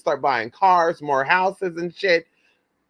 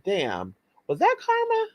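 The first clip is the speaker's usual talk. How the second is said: stunned disbelief; 4 semitones higher than usual. Real, not acted. Reacting to his death.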